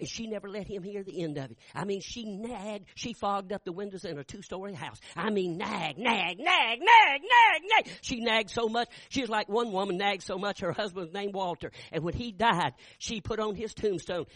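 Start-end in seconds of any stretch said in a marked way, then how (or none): 5.6-7.9 building from plain to high loud nagging; mock nagging. Preacher mimicking a nagging wife, building high and loud. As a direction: ramp up the nagging higher and louder.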